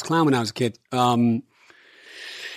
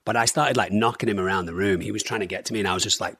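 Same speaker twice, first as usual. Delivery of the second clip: ordinary talk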